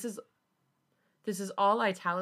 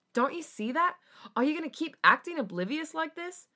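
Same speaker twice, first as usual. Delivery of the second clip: high, raised exasperated questions; exasperated confrontation; 10 semitones higher, 4 dB louder than usual. A narrator's reading. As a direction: challenge him, pitch climbing. Felix confronts Jisung.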